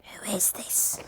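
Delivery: soft whispered who is this; creepy whisper. Shown as a demonstration. Second take of the caller, whispered. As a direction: breathe it out like a ghost on the line.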